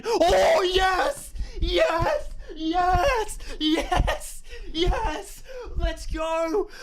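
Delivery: real screams of joy at the World Cup qualification; ecstatic shouting. Real, not acted. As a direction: lose it completely as the winning save goes in. Australia win shootout, live reaction.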